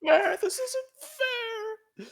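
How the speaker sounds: high, loud sobbing wail; crying whine. Acted. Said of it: "crying now".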